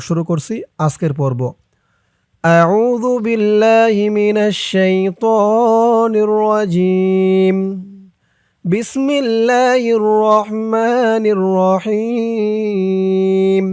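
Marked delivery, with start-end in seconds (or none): 2.4-7.6 slow melodic tajweed chant; Quran recitation. Shown as a demonstration. 8.6-13.6 slow melodic tajweed chant; Quran recitation. Shown as a demonstration. Recited basmala.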